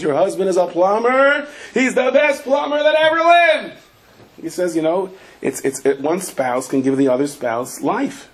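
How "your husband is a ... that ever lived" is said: enthusiastic praise. Retold or imitated.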